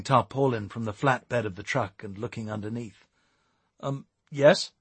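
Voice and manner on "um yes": hesitant, uneasy admission; hesitate, then answer nervously. A narrator's reading. Eric answering the second officer, nervously. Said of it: "replied Eric nervously".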